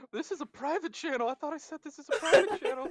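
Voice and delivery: mock panic, insisting; alarmed repeat. Streamer recalling surprise guest, mock alarmed insistence. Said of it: "I was like".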